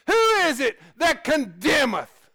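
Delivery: loud, high preacher's cry; preaching at full force. Acted. Preacher crying out the verse to his church. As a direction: call the question out over the pews.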